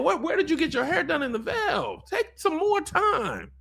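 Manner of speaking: excited scolding. Real, not acted. Scolding Rhaena about her hair.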